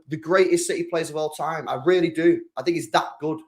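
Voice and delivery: ordinary talk, nothing marked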